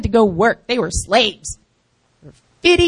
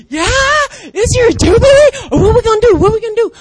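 excited friend; 10 semitones higher, 7 dB louder, faster than usual. Retold or imitated. Friend excited about your jubilee.

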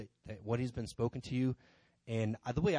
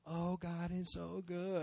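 high, soft, slow pious coo; mock-pious gushing; 7 semitones higher, 6 dB quieter, much slower than usual. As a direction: gush it sweetly as a parody of piety. Mocking pious Facebook posts.

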